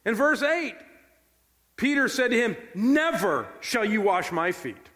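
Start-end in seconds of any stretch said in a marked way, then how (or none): 2.7-4.9 "Never" punched high and drawn out; emphatic no. Acted. Peter refusing to let Jesus wash him, stress on "Never". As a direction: land hard on "Never", then slowly finish. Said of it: "It is the strongest emphatic no available".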